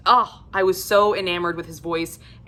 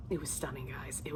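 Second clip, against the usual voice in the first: hushed awe; 5 semitones lower, 16 dB quieter than usual. Real, not acted. Awed by the narration.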